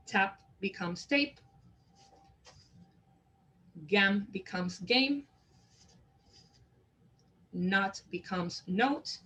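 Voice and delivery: "Tap" then "tape", slow and clear, vowel turning long; slow contrast demo. Shown as a demonstration. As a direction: drill each pair slowly, stressing the long vowel. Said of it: "Tape is the example".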